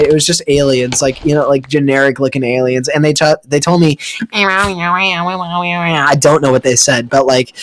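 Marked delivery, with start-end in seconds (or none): 4.3-6.1 slow warbling alien babble; alien gibberish. Retold or imitated. Tiny aliens talking, slow warbling alien gibberish. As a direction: garble it like a little alien. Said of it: "they sounded like that".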